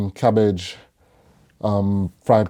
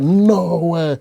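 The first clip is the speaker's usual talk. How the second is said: amazed; 9 semitones higher, 5 dB louder than usual. Retold or imitated. Retold seeing own name.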